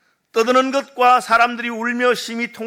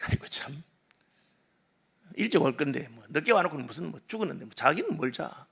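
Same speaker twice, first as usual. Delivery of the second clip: scoffing; 5 semitones lower, 11 dB quieter than usual. Retold or imitated. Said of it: "비웃습니다".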